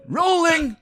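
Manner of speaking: high-pitched mimicked set call; mock call across a film set; crew call. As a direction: sing it out like a crew relaying a cue. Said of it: "everyone says "rolling".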